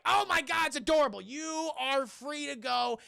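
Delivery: delighted gushing. Retold or imitated. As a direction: gush as if utterly charmed. Delighted couple after the dance.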